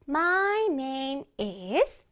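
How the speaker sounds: "My name is" stretched note by note; very slow, melodic lead-in on "My name is"; piano-like intonation. Shown as a demonstration. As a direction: hold each word of "My name is" like a piano note. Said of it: "내 몸이 피아노가 된다고 생각해야 돼".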